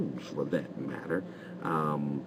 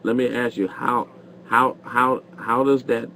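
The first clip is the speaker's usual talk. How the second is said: exasperated pressing; 6 semitones higher, 4 dB louder than usual. Real, not acted. Host presses caller for answer.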